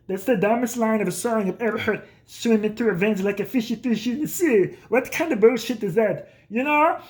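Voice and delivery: mock a dad sneering at modern music; imitated dad. Dad hating the song in the car, mock disgusted. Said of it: "and he'd be like".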